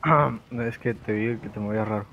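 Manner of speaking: usual speaking voice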